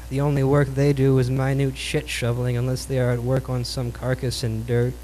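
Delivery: ordinary delivery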